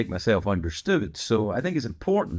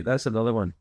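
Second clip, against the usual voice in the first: ordinary talk, nothing marked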